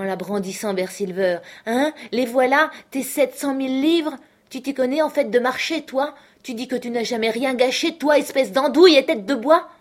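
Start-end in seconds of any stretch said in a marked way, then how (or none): 1.7-9.7 furious taunting, higher and louder; angry. Acted. Mary furiously taunting Silver about the treasure. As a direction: rage at him, mocking every word.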